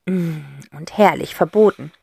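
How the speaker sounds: ordinary delivery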